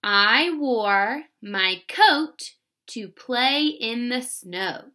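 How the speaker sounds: slow, word by word dictation; slow teacher dictation. Shown as a demonstration. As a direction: read it slowly for spelling. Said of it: "Number one is".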